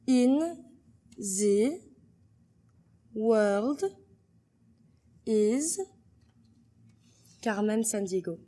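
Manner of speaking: word by word in a French accent; French-accented, each word set apart. Shown as a demonstration.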